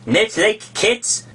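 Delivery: high, slow indignant mouse; shrill, passionate. Acted. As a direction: squeak it out, offended. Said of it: "in a shrill, passionate voice".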